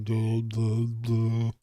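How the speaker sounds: dopey mumbled duh; dumb duh. Retold or imitated. A man mocking his own tongue, dopey duh. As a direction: play a brain-dead mouth stumbling. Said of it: "the big stupid meat hole is like".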